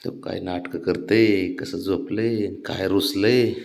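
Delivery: slow, soft mocking drawl; mock sulk. Real, not acted. Mocking how the child sulks. (रुसण्याची हळू, हळव्या आवाजातली नक्कल)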